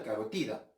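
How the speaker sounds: low, soft carotida, stressed ca-ro-TI-da; stress on penultimate. Shown as a demonstration. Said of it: "entonces es una grave". (carotida grave, acento en TI)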